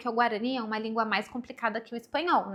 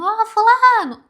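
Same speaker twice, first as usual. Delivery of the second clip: loud, high street yell calling someone; 11 semitones higher, 14 dB louder, slower than usual. Retold or imitated. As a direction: shout a name across the street, slow and drawn out. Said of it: "gente gritando". (grito alto chamando alguém na rua)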